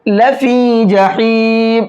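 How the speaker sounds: لفی جحیم recited slowly with the ی held one alif long; stretched ya. Shown as a demonstration. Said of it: "ایک الف کے برابر کھینچیں گے". (ی کو ایک الف کے برابر کھینچ کر تلاوت)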